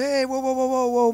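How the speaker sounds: high, sassy whoa whoa whoa. Retold or imitated. Preacher sassing the officer who pockets the money, high and sassy. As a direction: push back with sass, voice up. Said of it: "I'm getting kinda sassy".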